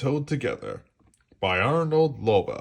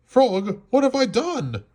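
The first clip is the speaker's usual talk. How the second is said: high, loud distressed cry; anguished cry; 12 semitones higher, 4 dB louder than usual. A narrator's reading. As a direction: cry out in panic for a lost friend. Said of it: "cried Toad".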